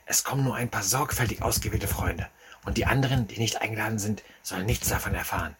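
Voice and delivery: conspiratorial. A narrator's reading. Secretive friend explains the guest list.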